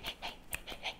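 usual speaking voice

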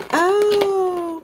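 high, bursting oh of surprise; delighted gasp. Real, not acted. Vlogger opening advent day two, delighted high gasp. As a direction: gasp at the cute toy inside.

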